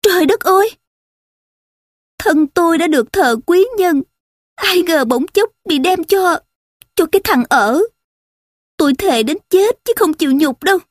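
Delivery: high, acted outcry of distress; performed anguished exclamation; horrified cry. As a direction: protest in shock, voice pitched well up. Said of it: "vội kêu thét lên".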